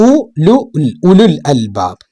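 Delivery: ഉലുൽ അൽബാബ് built up slowly, short ഉ, no long ഊ; ഉലുൽ അൽബാബ് with the waw and alif silent; careful Arabic reading. Shown as a demonstration. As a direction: sound out ഉ ലു ഉൽ then join it. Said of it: "ഈ അലിഫും വായിക്കൂല".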